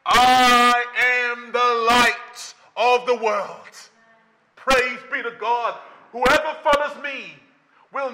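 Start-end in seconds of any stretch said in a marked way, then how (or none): 0.1-3.9 "I am the light of the world" stretched out very slowly; solemn slow declaration of "I am the light of the world"; slow proclaiming. Acted. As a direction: intone "I am the light of the world" word by word.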